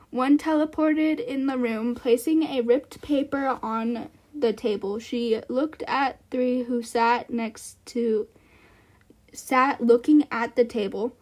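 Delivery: loud, measured reading voice; story narration. A narrator's reading. Streamer reading her story aloud to chat. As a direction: read it out like a storyteller.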